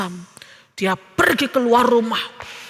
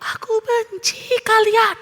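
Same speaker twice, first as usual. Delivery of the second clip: answering echo; 7 semitones higher, slower than usual. A narrator's reading. Unseen voice answers Theo from the valley, echoing. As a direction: call it back drawn-out, like a distant echo. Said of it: "ada suara yang menyaut".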